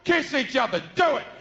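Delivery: hammed-up pushy shout; shouting order. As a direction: bark it at them, pushy.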